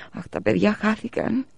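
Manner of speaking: high, slow tearful wail; a lightly acted sob of despair; bursting into tears. A narrator's reading. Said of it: "ξέσπασε σε κλάματα η μαμά". (ελαφρύ κλάμα μητέρας)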